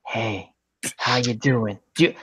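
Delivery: a hint of a soft, seductive recorded voice; sultry phone line. Dialing the warranty number reaches a sex line, soft come-on.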